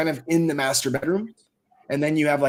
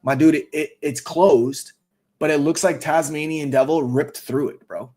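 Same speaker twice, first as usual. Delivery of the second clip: ordinary delivery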